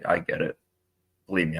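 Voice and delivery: ordinary delivery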